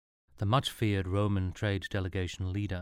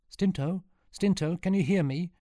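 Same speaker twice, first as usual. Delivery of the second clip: higher, louder calling into the helmet; 10 semitones higher, 4 dB louder than usual. A narrator's reading. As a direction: call out to a friend trapped inside. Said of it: "called Sean to the helmet".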